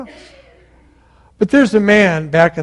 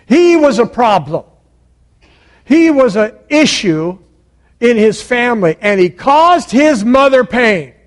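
high, loud, building force; emphatic preaching; 6 semitones higher, 5 dB louder than usual. Real, not acted. Preacher listing the blame Jabez lived under, emphatic preaching. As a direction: hammer each charge, rising to the last.

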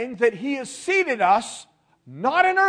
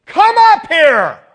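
loud, high, drawn-out summons; trumpet-like voice; 8 semitones higher, 16 dB louder, much slower than usual. Acted. Voice from heaven summons John, trumpet-like shout. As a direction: call it out like a trumpet blast. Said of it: "first voice which I heard was like a trumpet".

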